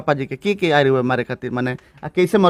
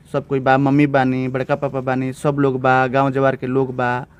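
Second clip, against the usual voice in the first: ordinary talk, nothing marked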